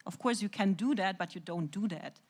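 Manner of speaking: ordinary delivery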